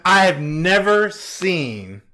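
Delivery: usual speaking voice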